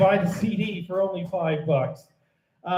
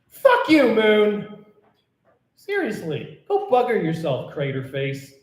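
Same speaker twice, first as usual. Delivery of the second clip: mock-angry ode; 3 semitones higher, 3 dB louder than usual. Poet curses the moon in her ode, mock-angry rant. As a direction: insult the moon with comic indignation.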